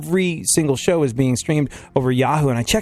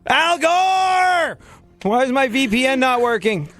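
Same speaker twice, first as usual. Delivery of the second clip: exasperated yell; 11 semitones higher, slower than usual. Retold or imitated. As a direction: yell at the computer, high and fed up. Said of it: "my first response is like".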